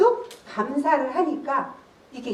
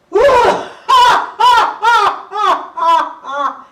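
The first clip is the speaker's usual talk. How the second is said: loud, high war-cry laugh fading out; general's battle laugh; 8 semitones higher, 7 dB louder, much slower than usual. Retold or imitated. General laughing boldly before the enemy commander, loud. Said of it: "이렇게 웃었죠?".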